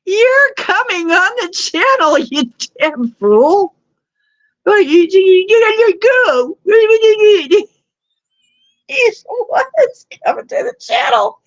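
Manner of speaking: high, loud shouting, nearly crying; mock furious yelling. Real, not acted. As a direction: yell at him to get off the channel. Yelling at someone in chat.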